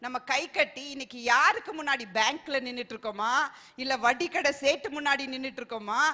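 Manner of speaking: ordinary talk, nothing marked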